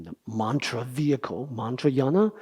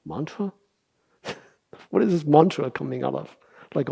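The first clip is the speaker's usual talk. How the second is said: normal talking voice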